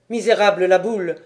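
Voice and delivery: loud cry of betrayal; furious outcry. A narrator's reading. Guédère, beside himself, curses la Boule in a loud furious outcry. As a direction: hurl the name out in rage. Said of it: "s'écria Guédère hors de lui".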